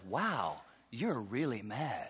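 son's calm remark. Retold or imitated. Son looking up at his angry father, deadpan and soft. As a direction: say it flatly, unimpressed. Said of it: "he looks up at me and says".

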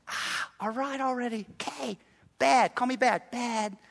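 soft, put-upon mock grumble; grudging whine. Pastor voicing a guilty listener's grudging, soft grumble. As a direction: sigh it out like a scolded kid. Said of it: "you've been thinking".

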